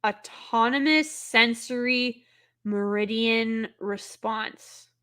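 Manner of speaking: slow careful reading. Shown as a demonstration. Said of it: "Southeastern Kaiju".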